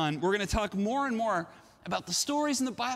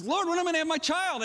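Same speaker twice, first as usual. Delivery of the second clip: high, quick frustrated plea; acted cry of complaint to God; 9 semitones higher, much faster than usual. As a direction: plead upward in rushed exasperation. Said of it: "he cries out to God".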